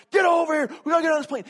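high, full-volume frantic holler; yelling. Retold or imitated. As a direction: yell at your wife to hurry, hammed up. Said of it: "he's yelling at his wife".

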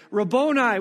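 crying out to her teacher; cried out. Acted. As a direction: call out his name in joyful shock. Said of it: "cried out in Aramaic".

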